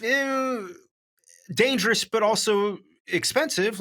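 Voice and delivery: squeaky disagreeing emphasis; high objection. Real, not acted. Correcting the co-host.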